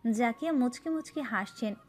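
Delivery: normal talking voice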